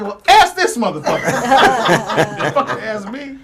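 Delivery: high, loud words broken by laughter; laughing hard while finishing the story. Real, not acted. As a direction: push the punchline out through the laugh. Laughing through the punchline.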